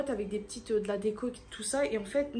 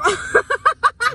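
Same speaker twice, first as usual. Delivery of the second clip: high embarrassed laugh; 12 semitones higher, 14 dB louder than usual. Real, not acted. Laughing at her haircut.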